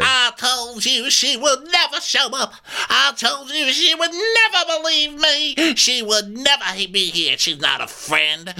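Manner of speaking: hammed-up screech, settling by the end; mock wailing rant. Telling someone she was never a friend, mock wailing rant. Acting out Erica's tirade.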